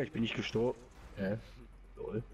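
ordinary delivery

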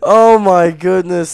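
loud, high, long drawn-out marvel; amazed exclamation. Real, not acted. Amazed at the find.

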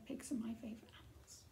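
ordinary delivery

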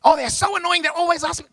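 hammed-up annoyed spouse; mock whiny complaint. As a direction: imitate someone fed up with their partner. Mimicking an irritated spouse.

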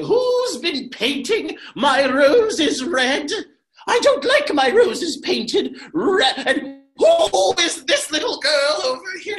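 high, loud, slow ranting queen; Queen of Hearts ranting at the gardeners, high and loud. Acted. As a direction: rage like a mad queen over her roses. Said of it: "She walks around going".

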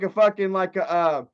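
ordinary delivery

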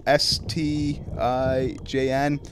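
slow, letter by letter spelling; spelled out slowly. Real, not acted. Streamer reading a subscriber's hard name, slowly spelled. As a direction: sound out each letter carefully. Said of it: "I can't pronounce your name".

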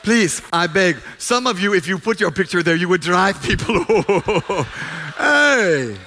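loud, high sermon delivery, driving each question home; fervent preaching. Acted. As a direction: preach it at full force to the congregation.

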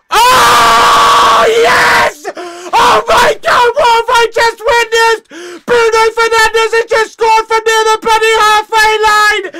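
screaming high and loud in disbelief; real fan's euphoric screaming; ecstatic goal scream. Real, not acted. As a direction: lose your mind over a wonder goal. Fan watch-along, goal celebration.

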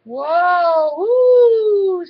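loud whoop climbing high; long, swooping "whoa-ooh" holler; excited holler. A narrator's reading. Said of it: "Sarah hollered".

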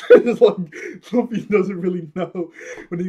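loud, amused words through laughter; laughing while talking. Real, not acted. Fan amused by Luffy's vague plan, laughing. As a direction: crack up while explaining.

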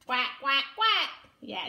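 ordinary delivery, nothing marked

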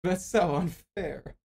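sulky, whining complaint. Real, not acted. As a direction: whine like a sore loser. Complaining about unfair loss.

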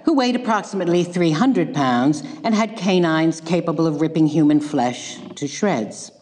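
ordinary talk

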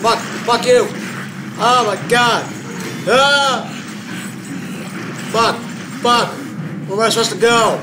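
loud, high, terrified screaming; panicking gamer. Real, not acted. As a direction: shriek it while being chased. Chased by monster in game.

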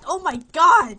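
loud, sudden, startled cry; real shocked shout; startled outburst. Real, not acted. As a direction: yell it out as the game jumps you. Startled by the game glitch.

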